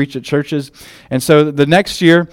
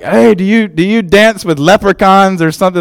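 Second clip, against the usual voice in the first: high, loud, eager prying voice; over-eager stranger asking about leprechauns; mock nosy questioner; 5 semitones higher, 4 dB louder than usual. People quizzing him about Irish stereotypes.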